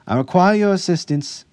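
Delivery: high, very loud summons; calling out. A narrator's reading. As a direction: summon the guard from afar. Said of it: "she called to the guard".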